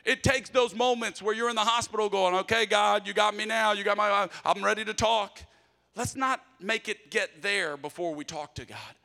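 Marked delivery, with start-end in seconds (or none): 0.0-5.4 louder and higher, driving sermon; full-force preaching. Acted. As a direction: preach it out to the room.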